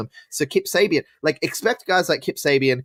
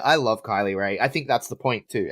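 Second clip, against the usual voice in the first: usual speaking voice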